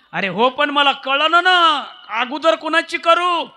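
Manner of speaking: loud, high worried king; the king fretting aloud over whom to honour first; king Janak's loud, high, anxious question. Acted. King Janak anxiously asks servant.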